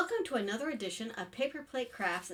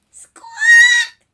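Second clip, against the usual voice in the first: piercing, very high parrot screech; parrot squawk; 23 dB louder than usual. Acted. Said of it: "screamed the parrot".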